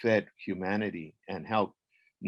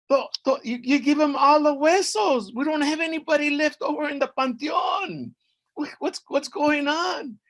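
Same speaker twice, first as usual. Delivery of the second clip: high, loud stammering objection; alarmed protest; 6 dB louder than usual. Acted. Lady of Mictlán protesting the bones given away, alarmed. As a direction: sputter and object at high pitch.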